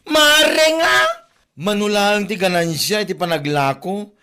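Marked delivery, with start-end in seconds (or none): 0.1-1.0 Mare nga! thrown back high and loud; scornful outburst. Acted. Creditor mocking the debtor who keeps pleading mare, sharp and loud. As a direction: fling the word back at her with scorn.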